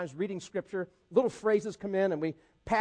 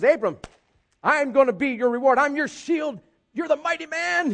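higher, louder mock voice of God; voicing God; 7 semitones higher, 6 dB louder than usual. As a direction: boom out God's promise, playfully. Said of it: "God shows up and says".

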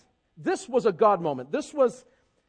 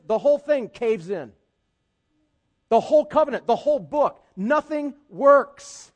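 higher and louder, fervent preaching; preaching at full force; 6 semitones higher, 7 dB louder than usual. Acted. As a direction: drive it home to the congregation. Preaching climax.

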